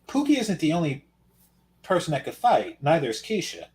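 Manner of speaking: usual speaking voice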